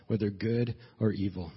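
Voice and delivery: ordinary talk, nothing marked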